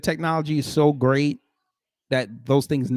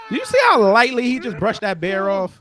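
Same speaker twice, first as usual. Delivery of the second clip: excited shouting. Real, not acted. Amazed by man brushing bear off.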